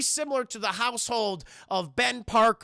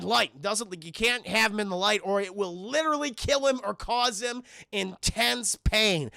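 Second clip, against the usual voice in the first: ordinary delivery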